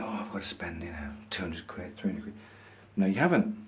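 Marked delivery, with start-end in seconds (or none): 0.0-2.3 hushed imitation of a fretful newcomer; whispered mock worry. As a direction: whisper it, lower and softer, as a worried beginner. Mock thought of a new buyer.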